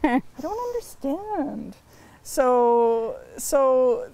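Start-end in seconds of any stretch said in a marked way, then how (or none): 0.0-1.8 confused newcomer. Retold or imitated. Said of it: "and I was like".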